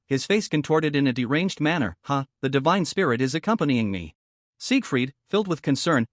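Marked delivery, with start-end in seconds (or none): none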